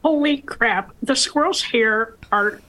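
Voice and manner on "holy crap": normal talking voice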